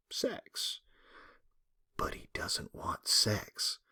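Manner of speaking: hushed, slow whisper of the secret; whispered reveal, slow. Acted. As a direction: lean in and whisper it. Confiding the twist to class.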